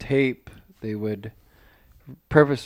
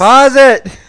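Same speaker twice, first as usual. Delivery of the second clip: high, loud insistent pause it; loud demand; 12 semitones higher, 14 dB louder than usual. Real, not acted. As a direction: yell it at him to pause. Pestering friend to pause.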